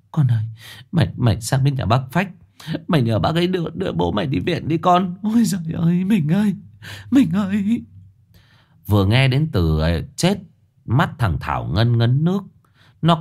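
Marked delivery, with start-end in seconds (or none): 0.1-7.8 tearful wailing. A narrator's reading. Frantic wife crying for her dying husband, wailing. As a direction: voice rising high into a wail. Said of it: "kéo thằng Thảo lại mếu máo".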